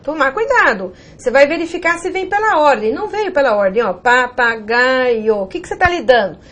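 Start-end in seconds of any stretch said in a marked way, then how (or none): 3.9-5.4 "Papagaio" drawn out slowly, each syllable held apart. Shown as a demonstration. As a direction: sound out "Papagaio" very slowly, piece by piece. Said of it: "fazer uma separação diferente". ("Papagaio" bem devagar, sílaba por sílaba)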